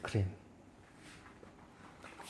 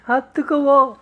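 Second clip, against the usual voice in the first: mouth full of hot food; 12 semitones higher than usual. Pretend. Pretend eating hot toy food.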